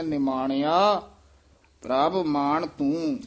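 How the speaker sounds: slow ਨਿਮਾਣਿਆ with a nasal yamak. Shown as a demonstration. Teacher shows students the nasal ਨਿਮਾਣਿਆ, slowly. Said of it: "ਨਿਮਾਣਿਆ ਤੇ ਯਮਕ ਲਾ ਦੇਣੀ ਹੈ".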